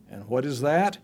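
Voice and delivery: ordinary talk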